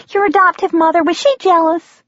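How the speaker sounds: waitress repeating her question to the guest; eager waitress. Acted. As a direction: lean in and press the juicy question. Waitress presses the question again.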